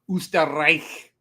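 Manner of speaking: "Österreich" said slowly, R trilled, ch at the back of the throat; drawn-out Austrian "Österreich" with a rolled R; Austrian accent, trilled R. Shown as a demonstration. Co-host shows how Austrians trill the R, in an Austrian accent. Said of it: "The Austrians trill their R's".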